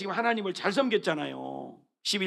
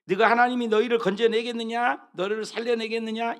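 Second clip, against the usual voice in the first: higher, louder scornful sneer; mocking taunt; 5 semitones higher, 6 dB louder than usual. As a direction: sneer the question like an enemy. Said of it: "이거 조롱하는 말입니다".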